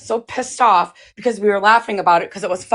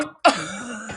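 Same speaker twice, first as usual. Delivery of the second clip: short laugh; 7 dB quieter than usual. Real, not acted. Laughs after her retold prank.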